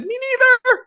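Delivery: squeaky disbelief. Retold or imitated. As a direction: squeak it out, overwhelmed. Retold emotional reply, squeaked.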